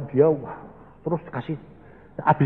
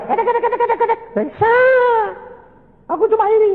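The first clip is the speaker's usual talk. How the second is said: much higher, repetitive babbling; preacher parodying someone's talk in a much higher voice; mocking imitation; 4 dB louder, much faster than usual.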